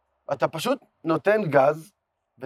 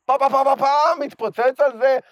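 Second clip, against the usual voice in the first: high, loud rapid-fire repeats; excited outburst; 9 semitones higher, 5 dB louder than usual. Real, not acted. Telling his buddy how motor lovers would love it, excited. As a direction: burst out with thrilled, raised emphasis.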